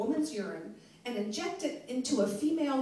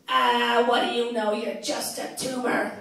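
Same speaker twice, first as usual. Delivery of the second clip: louder dismissive raspy mom, slow at the end; raspy smoker mom; 7 dB louder, slower than usual. Retold or imitated. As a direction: wave him off like a gruff old smoker. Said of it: "that really raspy smoker's voice".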